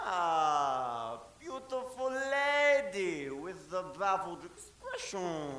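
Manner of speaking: slow, low, soft seductive purr; slow and syrupy, lingering on every word; a hint of a hammy lover; lightly voiced Latin lover. Aldolfo flirts with the lady.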